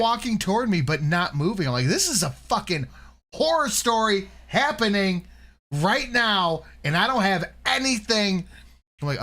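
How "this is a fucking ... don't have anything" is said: high, emphatic retold alarm; mock panic. Recounting his drunken fright at a horror story happening, mock panicked. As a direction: pitch it high, punching each phrase in alarm.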